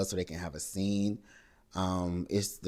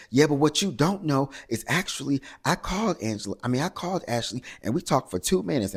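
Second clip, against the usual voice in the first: higher and louder, sure she's won; mock triumphant comeback; 7 semitones higher, 4 dB louder than usual. Angela claims her one-up to the table, raised and smug. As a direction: deliver the gotcha with a raised, smug push.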